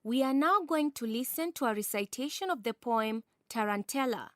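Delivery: ordinary talk, nothing marked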